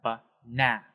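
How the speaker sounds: "banana" with weight on the middle "na"; teacher's demo of "banana", middle syllable emphasized; stressed second syllable. Shown as a demonstration. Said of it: "we have that second syllable".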